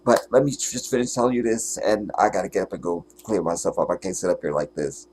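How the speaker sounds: normal talking voice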